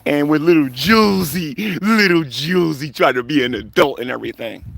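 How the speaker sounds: sing-song taunting of a rival; mocking. Streamer belittles little Julesy for acting adult, mocking. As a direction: tease them like a little kid.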